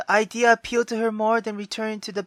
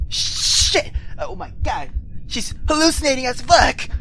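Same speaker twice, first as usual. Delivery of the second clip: shocked; 6 semitones higher, 4 dB louder than usual. Real, not acted. Shocked reaction to creepy scene.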